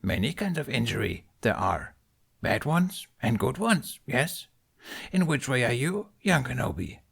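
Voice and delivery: Yoda. A narrator's reading. Yoda teaches Ben over tea.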